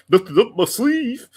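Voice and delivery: high, slow cartoon moose voice; Bullwinkle voice. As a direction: drawl the old cartoon line, high.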